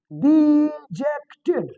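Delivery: डिजेक्टेड spelled out slowly, di-jek-ted; slow careful word. Shown as a demonstration. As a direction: say डिजेक्टेड slowly, each syllable clear. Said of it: "word आ जाता है डिजेक्टेड". (डिजेक्टेड को धीरे-धीरे, हर syllable साफ़ बोलो)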